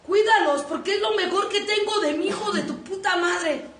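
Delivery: loud, high yell of cuídalos; mock furious yell; shouted command. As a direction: bark the order to take care. Shouted warning repeated.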